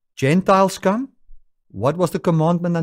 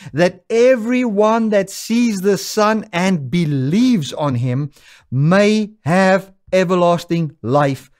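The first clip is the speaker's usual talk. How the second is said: preaching scripture; 2 semitones higher, 3 dB louder than usual. Acted. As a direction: swell up as the verse comes. Preacher rereads verse, rising intensity.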